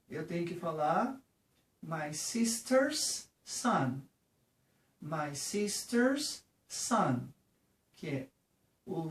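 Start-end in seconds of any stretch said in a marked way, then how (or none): 1.8-7.3 My sister's son, drawn out word by word; my sister's son, very slow and clear; slow demo. Shown as a demonstration. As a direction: spell it out slowly for students. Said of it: "slow teaching demo".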